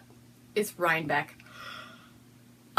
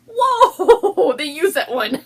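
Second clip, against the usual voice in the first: loud, high whoa breaking into laughter; laughing whoa; 10 semitones higher, 10 dB louder, much slower than usual. Real, not acted. Vlogger reacting to curse words on the bag, laughing loudly. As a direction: shriek with delight and laugh. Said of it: "I'm like".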